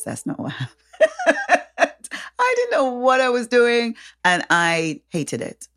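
ordinary talk, nothing marked

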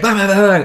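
loud, high mock gunfire; cartoon shooting noises; imitated gunfire. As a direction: blast out the bangs like a gun.

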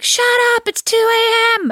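high, loud yell out the bedroom window; shouting out the window. Retold or imitated. Husband yelling from the window at noisy neighbours, loud and high. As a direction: bellow it across the street at night. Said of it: "shouting out the window".

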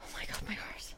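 breathless whisper after a shock; barely voiced, recovering from a jolt; shaken whisper. Real, not acted. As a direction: whisper it, utterly spent.

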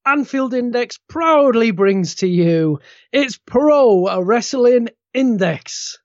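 booming circus ringmaster announcement. Pretend. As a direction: roll it out like a big top ringmaster. Podcast intro, circus announcer style.

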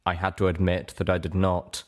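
usual speaking voice

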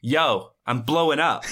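lightly put-on excited catchphrase; Serial. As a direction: call it out like a hyped hacker. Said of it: "what does Serial always say".

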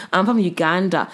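ordinary delivery

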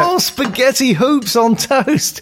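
high, slow, dazed voice of a returned spaceman; returned astronaut. Acted. Astronaut home from space, to his wife, high and slowed down. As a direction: play a spaceman who is high and slowed right down, lost back on Earth.

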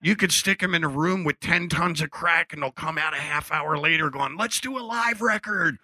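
retelling Dennis's skit, mock stoner on the end; imitating comedian's bit. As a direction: ramp up into a lightly voiced comedian's punchline. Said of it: "He goes".

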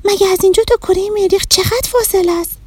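high, fast amazed question; can't believe the distance; astonished child. Acted. Shadi asking the Martian, amazed. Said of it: "با تعجب پرسید".

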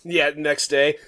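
normal talking voice

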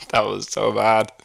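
ordinary delivery

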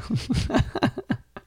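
breathy high-pitched giggle; airy high laugh. Real, not acted. Laughing at Bertha.

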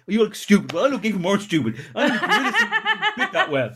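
high, giggly mock quarrel; laughing retold bickering. Locked-out couple in gorilla suit and wedding dress, laughing mock bickering. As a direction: crack up while acting out the argument.

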